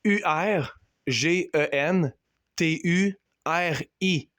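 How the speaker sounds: slow, deliberate letter-by-letter spelling; spelled letter by letter. Shown as a demonstration. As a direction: spell each letter slowly and clearly. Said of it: "ça s'écrit comme ça".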